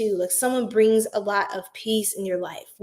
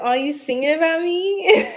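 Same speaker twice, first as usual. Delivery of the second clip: retold viewer question; 6 semitones higher, 4 dB louder than usual. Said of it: "So he said".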